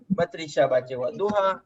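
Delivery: ordinary talk, nothing marked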